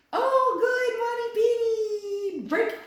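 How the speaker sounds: very high, loud gushing praise; high excited praise. Real, not acted. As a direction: gush over the dog. Shouted praise to dog.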